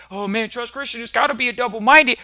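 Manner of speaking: lightly hammed-up complaining client; mock client whine. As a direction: sulk like a torn client. Mocking a client.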